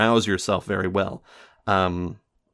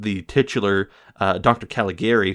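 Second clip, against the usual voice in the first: ordinary talk, nothing marked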